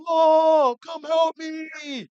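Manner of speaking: loud, high, drawn-out wail for Mom; yelling for help. Retold or imitated. Man hauled off the field calling to his mother, yelling for help. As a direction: holler it high and stretch out the plea. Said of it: "he was yelling".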